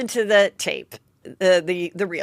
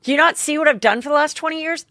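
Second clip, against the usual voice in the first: higher, faster exasperated rant; venting at kid; 6 semitones higher than usual. Retold or imitated. Mom fed up with her kid, exasperated rant. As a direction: let the frustration spill out fast. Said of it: "what you wanna say to your kid".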